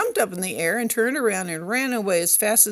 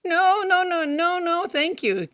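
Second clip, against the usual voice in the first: frightened cry; 9 semitones higher than usual. Acted. Little elephant panicking as the tiger offers to nibble him. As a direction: squeal it out in alarm. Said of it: "cried Sookie".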